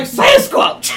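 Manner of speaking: high laughing yelp of surprise; startled shout. Pretend. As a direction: blurt it out in fright. Man startled by Sasquatch.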